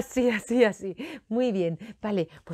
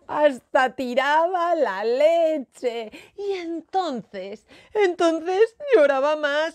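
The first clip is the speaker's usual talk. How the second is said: slow, higher, drawn-out blubbering; mock wailing narration; 6 semitones higher, 4 dB louder, much slower than usual. A narrator's reading. Storyteller caught up in Moncho's tantrum, wailing along. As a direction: tell it as if bawling, stretching the words.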